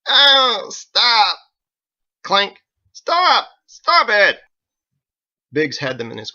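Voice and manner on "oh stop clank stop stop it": loud, high, crying stop; pained pleading yells. Acted. Drunk kicked again and again, loud pleading cries. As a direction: beg him to quit kicking.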